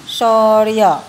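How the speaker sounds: शौर्य stretched out, र audible before य; pronunciation demo. Shown as a demonstration. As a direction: model शौर्य loudly, part by part, र ahead of य. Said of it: "आप उच्चारण करके फिर से एक बार देखिए".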